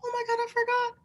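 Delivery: high, softened mock dismay; dismayed realization. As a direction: squeak it out as a sudden realization. Acting out own dismayed realization.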